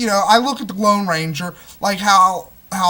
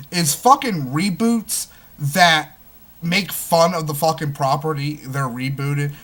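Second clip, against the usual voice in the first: normal talking voice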